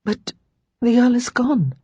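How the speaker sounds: hesitant, stunned objection; numb protest. A narrator's reading. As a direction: say it numbly, in disbelief. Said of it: "Lara said numbly".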